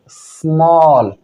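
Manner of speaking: स्मॉल stretched slowly, vowel held long; slow drawn-out model word. Shown as a demonstration. Teacher repeats स्मॉल for students, slow and drawn out. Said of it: "क्या हो गया?".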